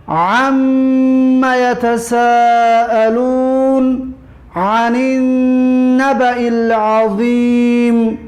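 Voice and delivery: slow, drawn-out chanted recitation; measured tajwid chant; Quran recitation. Acted. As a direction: intone each verse slowly and evenly.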